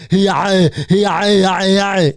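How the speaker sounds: mock a lazy Arnold impression; a hint of Arnold grunting. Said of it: "is an impression of Arnold".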